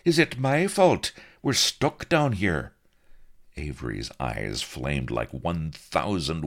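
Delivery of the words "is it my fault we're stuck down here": high, louder protesting rogue. A narrator's reading. Blood protests loudly.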